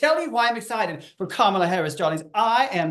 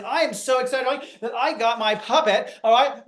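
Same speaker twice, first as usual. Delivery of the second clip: ordinary talk, nothing marked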